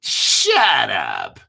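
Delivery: low, loud playful shout; hammed-up retort; mock snap. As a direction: bark it back jokingly. Snapping back at a teasing chatter.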